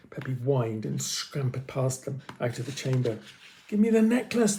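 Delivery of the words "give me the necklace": demanding. Acted. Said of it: "the man demanded".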